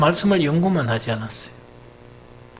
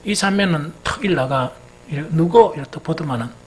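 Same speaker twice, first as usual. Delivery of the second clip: ordinary talk, nothing marked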